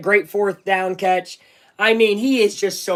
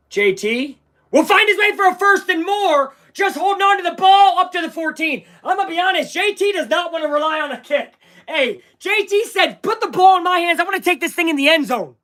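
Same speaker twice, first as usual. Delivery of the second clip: higher, rising commentary; excited play-by-play; 7 semitones higher than usual. Real, not acted. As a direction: call the drive with building hype.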